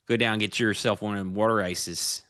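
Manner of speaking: put-on Philly accent, water as wooder. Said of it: "as they would say".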